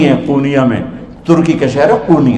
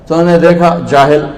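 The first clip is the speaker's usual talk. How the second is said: ordinary talk, nothing marked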